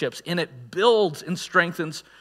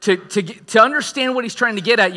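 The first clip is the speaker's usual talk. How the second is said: ordinary talk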